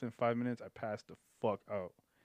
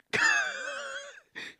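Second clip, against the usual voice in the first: high laugh. Real, not acted. Laughing at story.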